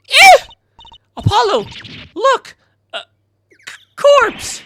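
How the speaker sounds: very high, loud, stammering alarm; panicked shriek. Acted. Girl showing Apollo a corpse, high panicked screaming. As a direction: shriek in bursts, stumbling on the last word.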